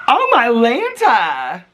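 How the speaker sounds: high, loud, stretched-out alarm; startled exclamation. Real, not acted. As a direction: cry out slowly in real surprise. Startled by noise while vlogging.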